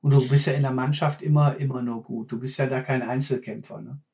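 ordinary talk

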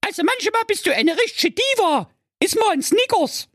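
hohe, schnippische Mädchenstimme; schnippisch. Acted. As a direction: frech und von oben herab. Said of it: "sagte die Jacqueline schnippisch". (die Prinzessin weist den Vater schnippisch zurecht)

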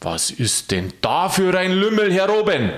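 high, sustained tone of amazement; astonished question. A narrator's reading. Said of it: "ganz erstaunt fragte".

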